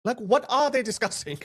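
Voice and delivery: ordinary talk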